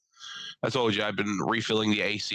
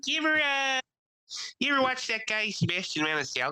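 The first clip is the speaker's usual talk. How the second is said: much higher, mock Italian fan voice; 13 semitones higher than usual. Host mocking Italians who rave about Sebastian, pitched high. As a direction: ham up an excited Italian fan.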